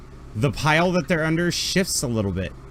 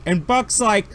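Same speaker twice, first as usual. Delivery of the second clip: ordinary delivery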